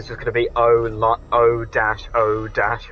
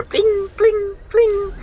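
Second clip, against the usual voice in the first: high chiming bling bling; marking sound; much slower than usual. Retold or imitated. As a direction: imitate the game's marking ping. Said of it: "makes the marking sound".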